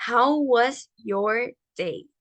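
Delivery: very slow, careful English model; slow model phrase. Shown as a demonstration. Teacher leading students to repeat slowly. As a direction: stretch it out for the class to repeat. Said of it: "pueden repetir después de mí".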